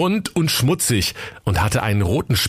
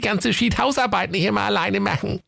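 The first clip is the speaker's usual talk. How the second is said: shrill squeaky housewife voice, much higher; high falsetto wife. Acted. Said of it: "sprach seine Frau zu ihm".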